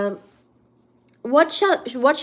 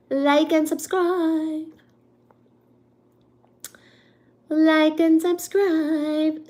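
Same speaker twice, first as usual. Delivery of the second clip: slow drawn out; 4 semitones higher, much slower than usual. Acted. Reminding viewers, drawn out.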